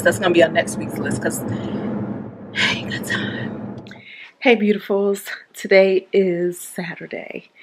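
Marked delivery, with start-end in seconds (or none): 0.0-3.8 slow whisper, shrugging it off; whispered aside. Real, not acted. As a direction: whisper it slowly like a secret excuse. Muttered aside about plumber.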